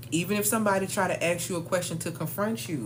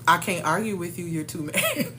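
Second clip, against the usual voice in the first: usual speaking voice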